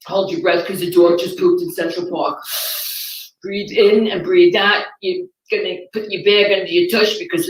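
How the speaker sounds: New York accent, mock-soothing guide; guided meditation in a New York accent. New York meditation tape to listeners, New York accent, deadpan. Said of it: "a New York guided meditation".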